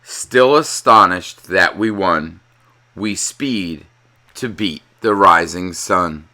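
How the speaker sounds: ordinary delivery, nothing marked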